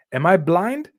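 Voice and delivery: ordinary delivery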